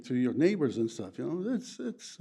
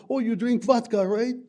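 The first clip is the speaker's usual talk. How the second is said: much higher, louder teasing question; curious American; 10 semitones higher, 6 dB louder than usual. Retold or imitated. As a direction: play the American poking fun about vodka. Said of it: "they ask you".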